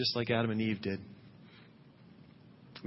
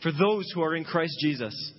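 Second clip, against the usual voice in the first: higher, louder proclamation; declared aloud; 7 semitones higher, 4 dB louder than usual. Shown as a demonstration. Preacher showing the congregation how to declare the verse. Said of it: "declare it, call it out".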